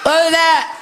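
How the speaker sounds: high and loud alarm; startled shriek. Acted. The wife startled by the garage door, loud and high. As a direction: jump at the noise.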